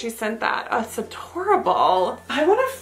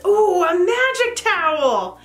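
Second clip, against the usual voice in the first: delighted squeal; 8 semitones higher, 3 dB louder than usual. Real, not acted. Delighted gift reveal.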